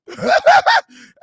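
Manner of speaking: very high shrieking laugh burst; high-pitched shout of laughter; loud laugh. Real, not acted. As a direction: let out a screaming laugh. Laughing at cousin story.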